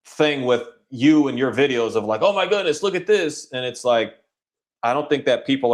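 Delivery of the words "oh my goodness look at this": high-pitched mock "oh my goodness" reaction; mock amazement. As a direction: imitate shocked viewers, much higher pitched. Mocking online viewers' reaction.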